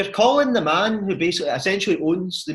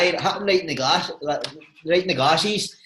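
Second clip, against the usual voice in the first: normal talking voice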